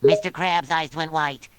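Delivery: normal talking voice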